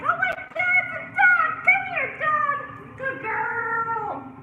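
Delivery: high, loud dog-lover squeal; a neighbor thrilled to see a dog; gushing neighbor. Retold or imitated. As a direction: random neighbor cooing at a dog. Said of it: "random neighbor that's like".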